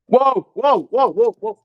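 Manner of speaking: real alarmed exclamations; startled whoas. Real, not acted. Startled by something in ghost hunt.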